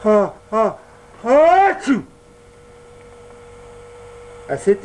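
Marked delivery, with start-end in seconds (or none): 0.0-2.0 big building sneeze rising high and loud; hammed-up giant sneeze. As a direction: wind up and explode into a sneeze. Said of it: "et éternua violemment".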